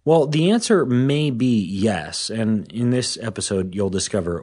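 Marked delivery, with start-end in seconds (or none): none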